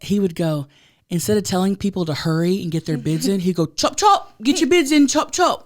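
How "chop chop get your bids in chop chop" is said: high, loud British auctioneer; imitated British accent. London auctioneer telling people to hurry, mock British accent. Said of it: "with his British accent".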